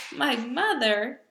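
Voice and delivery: loud, slow outburst of disbelief; astonished cry. Acted. Giant cries out to Sophie in disbelief, loud. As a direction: blurt it out in shock. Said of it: "cried the B F G".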